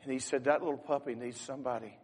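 loud, high, impassioned story telling from the pulpit; fervent sermon storytelling. Acted. Preacher to congregation, telling the puppy story with loud fervor. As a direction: preach the story out with rising force.

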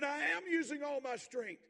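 high, loud tantrum shout; an eleven-year-old's fit; blowing his top. Retold or imitated. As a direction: son exploding at his father. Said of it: "He really blew his top".

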